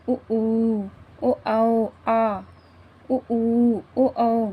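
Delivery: उ, ऊ, ओ, आओ said slowly with lips pushed round; teacher shapes each vowel with rounded lips, drawn out; बोलिए: उ ऊ ओ with lips made round, each vowel held; rounded-lip vowel demo. Shown as a demonstration. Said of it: "देखिए हमारे होठ गोल".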